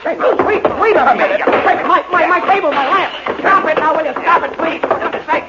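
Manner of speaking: high, frantic pleading as his furniture breaks; panicked pleading. Acted. Homeowner begging brawlers to spare his lamp, high and frantic. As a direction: beg them to stop, voice climbing in panic. Baker panics during a fight.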